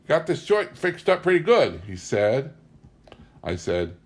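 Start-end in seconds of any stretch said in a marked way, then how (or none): 0.1-1.8 put-on insolent drunk. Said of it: "Geoduck looked insolently around the kitchen".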